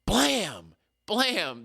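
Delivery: punchy blam sound effect; hammed-up crash sound; impact noise. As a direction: mimic a body hitting the wall. Imitating the suspect being slammed.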